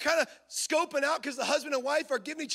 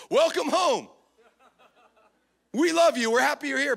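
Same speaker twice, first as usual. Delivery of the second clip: loud hollow welcome; 6 dB louder than usual. Retold or imitated. Church sign greeting, performed.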